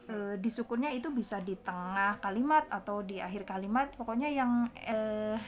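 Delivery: ordinary talk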